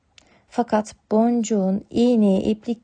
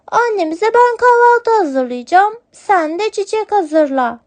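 much higher, louder little kitten making a plan; 10 semitones higher, 4 dB louder than usual. Acted. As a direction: kitten cheerfully dividing the tasks. Kitten plans breakfast. (yavru kedi sesi, neşeli)

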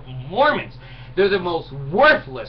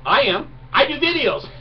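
ordinary talk, nothing marked

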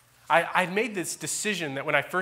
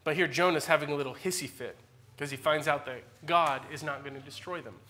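usual speaking voice

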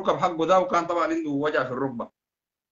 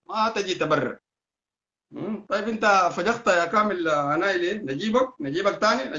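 usual speaking voice

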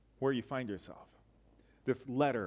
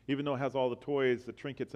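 ordinary talk

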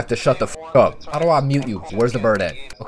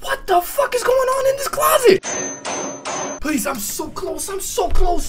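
high frantic begging, slow at first; real terrified pleading; high panicked pleading; much slower than usual. Real, not acted. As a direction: plead desperately as the timer runs. Panic in closet scene.

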